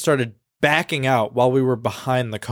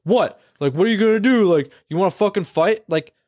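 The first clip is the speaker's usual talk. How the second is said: mock aggressive driver; 5 semitones higher than usual. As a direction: bark it out like a hostile driver. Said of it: "starts being like".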